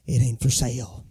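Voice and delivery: firm refusal. Shown as a demonstration. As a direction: drop the voice and shut the deal down. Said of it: "sometimes you just gotta say".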